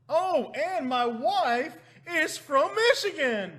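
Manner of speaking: high, drawn-out loud announcement; mock exclamation. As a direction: holler it slowly as a teasing reveal. Mock exclamation about his wife's origin.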